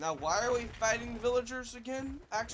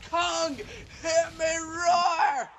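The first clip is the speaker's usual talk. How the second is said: drawn-out, loud, high roar as Kong; roaring giant ape; 9 semitones higher, 7 dB louder, much slower than usual. Pretend. Player roaring as giant Kong in a game, drawn-out shout. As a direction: bellow it long like a monster.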